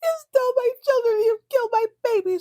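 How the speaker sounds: high, sobbing, shrieking accusation; furious grieving mother. Retold or imitated. Recapping Alexis screaming at Blake, high sobbing wail. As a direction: wail it like a mother who lost her child. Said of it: "She's so pissed".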